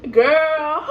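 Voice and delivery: put-on loud high sassy call of girl; mock scold. Mom scolding daughter, loud high girl.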